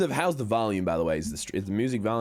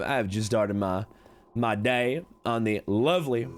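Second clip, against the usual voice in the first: usual speaking voice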